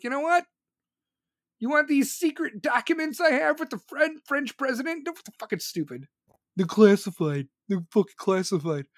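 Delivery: a hint of a smug secret-seller; mock traitor Trump. Mocking Trump as a secret-selling sellout, lightly voiced. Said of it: "someone who wants to be like".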